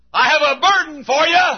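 high-pitched preacher's call; acted high call to the shallow; deep calling out. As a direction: call it across, high and ringing. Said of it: "deep calls unto shallow".

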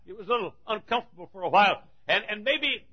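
usual speaking voice

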